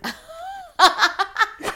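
helpless high giggle; high laugh. Real, not acted. Woman tickled by her own imitation, high giggling laugh. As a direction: crack up high and long. Cracking up at the pronunciation.

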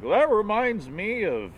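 high, slow mock windup; mock old storyteller man. Mocking how men start their stories, high and slow. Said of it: "Men are just like".

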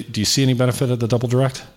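ordinary delivery

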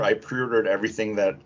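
ordinary delivery

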